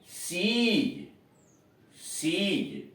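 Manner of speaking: सीज with a long, drawn-out ee. Shown as a demonstration. Teacher models the spelling rule for students, सीज with a stretched ee. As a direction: hold the vowel of सीज before closing it. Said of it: "स पर बड़ी की मात्रा सी".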